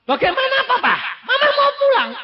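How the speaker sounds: high and loud, laughing through it; laughing exclaim. Retold or imitated. Child telling father mother is going home, laughing loudly. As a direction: blurt it out laughing in disbelief.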